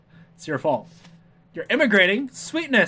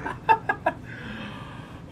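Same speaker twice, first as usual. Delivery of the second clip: high breathy laugh; 14 semitones higher, 8 dB quieter than usual. Real, not acted. Laughing at own joke.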